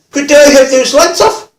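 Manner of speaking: high, loud, drawn-out call; stretched mock shout, pitched right up; called-out request. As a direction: imitate a bloke calling slowly across a room. Imitating offender calling out request.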